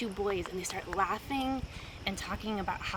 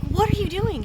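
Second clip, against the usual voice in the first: startled question; 10 semitones higher, 8 dB louder than usual. Retold or imitated. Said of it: "And I was like".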